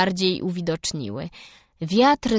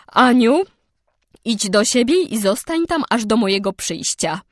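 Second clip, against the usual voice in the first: stern order; 3 semitones higher, 6 dB louder than usual. A narrator's reading. Marilla ordering Anne out.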